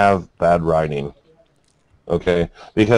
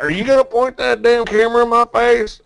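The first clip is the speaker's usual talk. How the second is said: pissed off hillbilly; 14 semitones higher than usual. Retold or imitated. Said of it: "And he's like".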